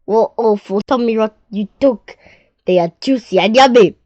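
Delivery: expressive read-aloud storytelling; story narration. Acted. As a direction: tell the crocodile tale to children. Reads The Enormous Crocodile aloud.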